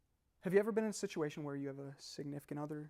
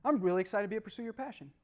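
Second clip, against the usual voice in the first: higher, lively enthusiasm; upbeat excitement; 6 semitones higher, 4 dB louder than usual. Shown as a demonstration. Speaker showing tonality to audience, lively and excited. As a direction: say it keenly, pitched up. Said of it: "three different ways".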